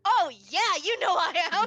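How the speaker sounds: loud, high, pumped-up boast; a contestant's eager brag; cocky excited contestant. Acted. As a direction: crow it like she's already won. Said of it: "you just see, like".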